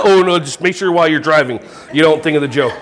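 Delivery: ordinary talk, nothing marked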